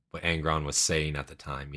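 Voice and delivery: ordinary talk, nothing marked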